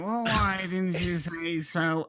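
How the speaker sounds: drawn-out sarcastic shopkeeper; mock delight, stretching the words and swooping up. Shopkeeper answering self-declared heroes, openly sarcastic. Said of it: "I wanna see if he's being sarcastic".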